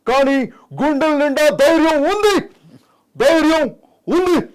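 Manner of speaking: slow, phrase-by-phrase oratory climbing very high; Pawan Kalyan. Acted. Host asks for Pawan Kalyan, impassioned speech impression. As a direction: pause between phrases, build to a piercing finish. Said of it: "Pawan Kalyan గారు".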